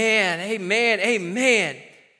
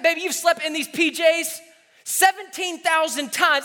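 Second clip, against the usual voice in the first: normal talking voice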